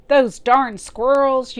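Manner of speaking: loud, high, slow cranky yell; old man yell. Acted. Old man yelling about the squirrels, loud and slow. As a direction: bellow it like a furious old man. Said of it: "yelled Old Man Fuquire".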